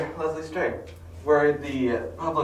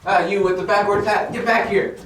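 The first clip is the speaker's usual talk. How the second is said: bossy shout; 4 semitones higher, 6 dB louder than usual. Acted. Brainwashed detective chasing people.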